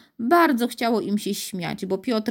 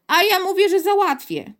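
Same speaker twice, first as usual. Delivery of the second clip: put-on high, loud stubborn boy; insistent childish comeback; defiant repetition; 10 semitones higher, 4 dB louder than usual. A narrator's reading. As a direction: throw it back at a higher pitch. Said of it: "powtórzył Piotr".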